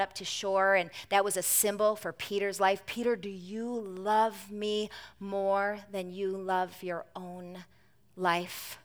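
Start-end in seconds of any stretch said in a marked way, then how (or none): 2.8-8.8 soft, slow, intimate question; softer, drawn-out loving question; tender Jesus. A narrator's reading. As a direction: ask gently, as if forgiving already. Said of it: "Jesus's tender question".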